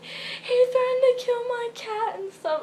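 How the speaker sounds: put-on high, slow, pleading kid voice; whiny tattletale. Girl tattling to neighbor lady, whiny kid voice. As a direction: play the wronged little girl.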